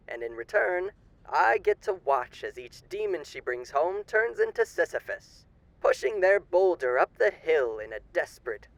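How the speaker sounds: silky, theatrical old-time radio announcer playing a charming demon; sly radio host. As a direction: purr every line like a grinning showman hiding menace.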